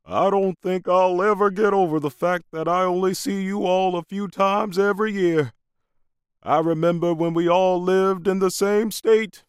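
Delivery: put-on high-pitched elderly grandfather; wistful old man remembering; old grandpa voice. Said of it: "Grandpa is remembering the way things used to be".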